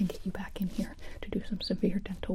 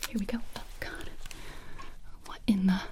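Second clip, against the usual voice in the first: ordinary delivery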